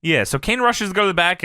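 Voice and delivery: ordinary delivery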